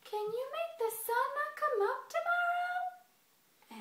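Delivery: very high, slow small-creature voice; little squirrel. Acted. Squirrel testing Bear's boast, high-pitched slow challenge.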